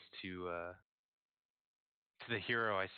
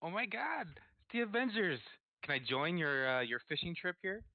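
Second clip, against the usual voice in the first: high, squeamish fangirl gush at the start; starstruck fan; 5 semitones higher than usual. Acted. Starstruck fan begging to join the Avengers' fishing trip, giddy. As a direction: act giddy at seeing celebrities, pitched up. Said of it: "squeamish, like I'm seeing a celebrity".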